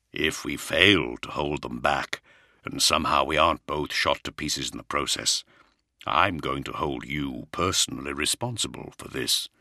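low, stern lord; weary commander, a touch amused. Acted. Primarch speaks.

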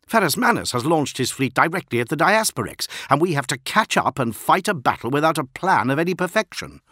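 heated, exasperated protest; angry outburst. A narrator's reading. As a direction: vent it loudly at a comrade. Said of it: "Heads turned at his outburst".

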